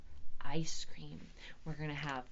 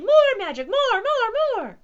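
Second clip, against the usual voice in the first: high, loud clamouring; thrilled cats shouting; excited cries; 14 dB louder than usual. A narrator's reading. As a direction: squeal it out eagerly. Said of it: "the cats cried excitedly".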